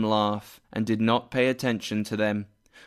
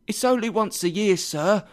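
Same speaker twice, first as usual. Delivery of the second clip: high, quick apologetic clerk; hurried, high-pitched excuse; anxious pleading; 8 semitones higher than usual. A narrator's reading. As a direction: plead nervously with the boss. Late clerk pleads with boss.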